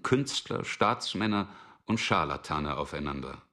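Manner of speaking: usual speaking voice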